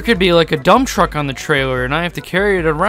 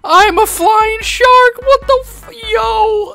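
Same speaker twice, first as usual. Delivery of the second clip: high, slow words squeezed out while laughing; delighted disbelief, cracking up; laughing about being a flying shark; hysterical laughter; 4 dB louder, slower than usual. Real, not acted. Turned into a shark.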